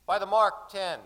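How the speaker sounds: shouted depth call. Acted. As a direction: call it back across the deck. Crewman calling out depth.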